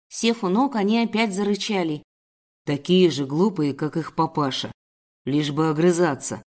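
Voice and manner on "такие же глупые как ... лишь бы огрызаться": low, scornful male villain; bilious sneer. Acted. Captor commenting on growling cubs, bitter sneer. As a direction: jeer with bitter contempt, pitched low. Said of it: "наполненный желчью".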